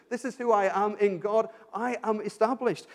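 normal talking voice

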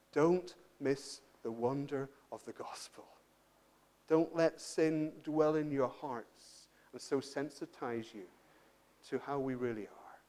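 soft, low, solemn appeal; hushed preaching. Real, not acted. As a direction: plead slowly and quietly, leaving long pauses.

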